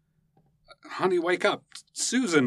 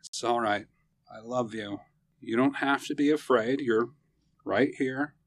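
comforting; 10 semitones lower, 4 dB quieter than usual. Acted. Husband comforting frightened wife.

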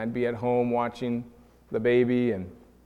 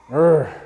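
loud, exasperated "urgh"; a short acted growl of frustration; frustrated grunt; 9 dB louder than usual. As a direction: let out an exasperated groan. Frustrated grunt at not being heard.

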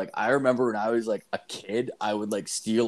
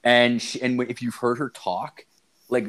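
ordinary talk, nothing marked

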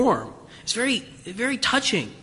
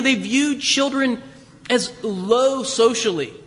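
normal talking voice

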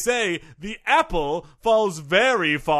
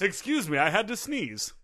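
prim cover-up; 8 dB quieter than usual. Acted. Guest covers up outburst.

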